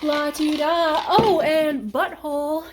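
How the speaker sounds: high, slow, loud prissy cat; sing-song it like a stuck-up cat strutting off; lilting la-ti-da from a snooty cat; haughty cat voice. Retold or imitated. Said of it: "They're just like".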